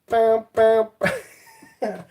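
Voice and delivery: high, sing-out pam sounds; playful "pam" noises. Pretend. Playful noises, then laughs and stops.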